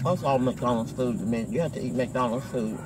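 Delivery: talking between bites; chewing while talking. Real, not acted. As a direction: mouth partly full. Seen 0: bites food at -2/-1, then talks holding food.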